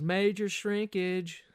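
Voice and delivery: slow, high drawn-out jeer; slow high teasing yell; mock yell. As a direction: heckle the cold swimmer. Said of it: "somebody yells".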